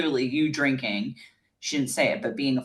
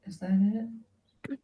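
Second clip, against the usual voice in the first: unimpressed; 6 semitones higher, 4 dB quieter, much slower than usual. Real, not acted. Unimpressed at the video.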